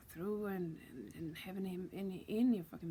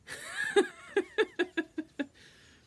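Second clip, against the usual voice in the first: very high, loud staccato cackle; mocking cackle; 11 dB louder than usual. Being warns it won't be easy, then cackles wildly. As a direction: shriek with derisive laughter. Being laughs after warning her.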